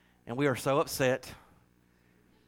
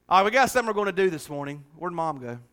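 ordinary talk

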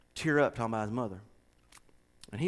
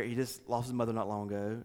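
ordinary talk

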